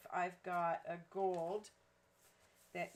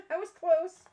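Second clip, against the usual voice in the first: excited; 9 semitones higher, 15 dB louder than usual. Real, not acted. Laughing after guessing measurement.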